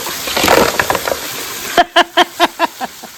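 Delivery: long high-pitched burst of laughter. Real, not acted. Long laugh amid group banter.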